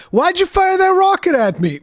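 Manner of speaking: mock upset complaint. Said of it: "he gets all upset at me".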